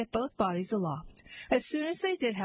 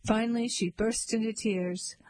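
plain reading voice

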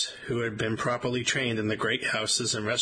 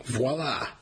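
proud little announcement; proclaiming. Acted. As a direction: declare it with a flourish.

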